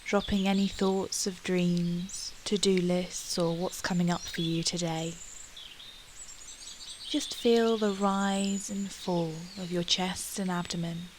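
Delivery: usual speaking voice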